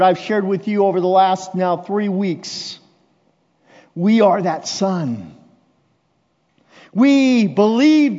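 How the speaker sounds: high, loud impassioned sermon; full-force preaching. Acted. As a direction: preach it out with rising passion.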